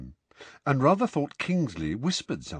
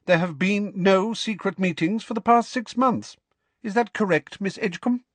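stern McGonagall; 11 semitones higher than usual. Acted. McGonagall questioning the student.

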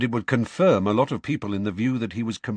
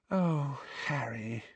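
a soft, sighing oh, much softer; sadly; 5 semitones higher, 8 dB quieter, slower than usual. A narrator's reading. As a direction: say it with gentle pity. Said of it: "she said sadly".

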